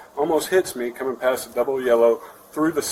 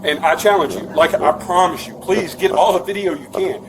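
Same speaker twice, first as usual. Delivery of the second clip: loud, high, challenging the officer; daring him, raised and heated; belligerent; 6 semitones higher, 6 dB louder than usual. Real, not acted.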